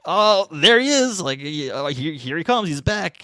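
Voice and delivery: high, loud gleeful "there he is"; mock excitement. Live-stream commenters spotting the reporter, mock excited cheer. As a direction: cheer the regular act arriving.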